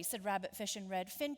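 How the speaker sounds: ordinary delivery, nothing marked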